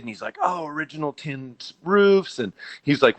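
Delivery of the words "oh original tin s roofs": imitating an excited visitor, pitched higher; a fan gushing over old roofs. Visitor gushing about the building's original roofs, excited and high. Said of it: "and he's all excited".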